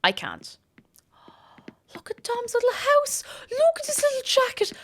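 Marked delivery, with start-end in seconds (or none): 1.9-4.7 very high squeal of adoration; gushing. Real, not acted. Streamer sees Dom's little house, high gushing squeal. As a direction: coo in a high, overwhelmed voice.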